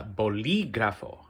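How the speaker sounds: "bolígrafo" with a long, stressed í; extra stress. Shown as a demonstration. Teacher gives a final accent mark example, extra stress on the "lí" of "bolígrafo". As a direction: lean on the "lí" in "bolígrafo". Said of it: "just put extra stress".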